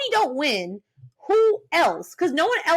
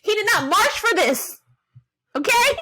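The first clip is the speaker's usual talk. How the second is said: heated rant; 7 semitones higher than usual. Real, not acted. Fan ranting to cohost about Cody, heated and high.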